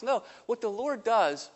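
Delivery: normal talking voice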